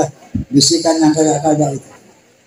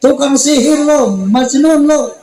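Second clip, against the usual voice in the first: high, jeering taunt at the Prophet; imitating a heckler jeering at the Prophet; mocking insult; 7 semitones higher than usual. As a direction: sneer it like a street heckler.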